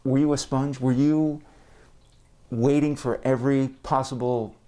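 muffled through a face mask; speaking through a mask. Real, not acted. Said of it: "if I sound a bit muffled in this episode".

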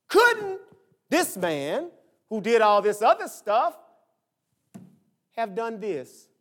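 pointed mock questioning, broken by pauses; mock challenge. Pastor voices a doubter at the graveside, mock challenge.

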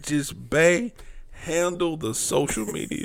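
quiet, deflated and worn out; defeated. Real, not acted. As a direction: say it like you've given up. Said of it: "You sound so defeated right now".

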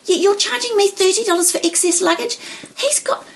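high-pitched indignant complaint; upset protest. Retold or imitated. Passenger challenging the clerk over excess luggage, upset. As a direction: retell it as an outraged passenger, voice climbing steeply. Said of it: "I was so upset".

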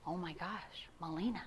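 barely voiced, stunned breath of the pastor; awed hush. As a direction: hush it out like you can't believe it. Pastor stunned reading debt letter.

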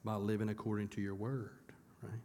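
soft unvoiced whisper of the answer. Real, not acted. Pastor whispering the answer to quiet students, hushed. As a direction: breathe the answer out to prompt the students.

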